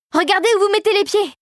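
high, urgent warning cry; alarmed warning. A narrator's reading. Philippe warns his soldiers about the pits, urgent high cry. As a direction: call it out urgently, pitched high.

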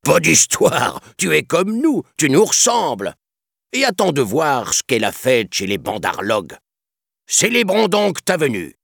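high, loud jeering king voice; monkey king. Acted. Monkey king dismissing Mowgli's protest, high jeering voice. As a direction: brush him off with showy glee.

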